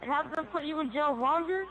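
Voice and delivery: ordinary talk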